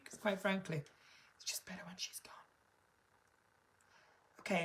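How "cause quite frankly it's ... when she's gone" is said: hushed secret aside; whisper. Real, not acted. Conspiratorial aside.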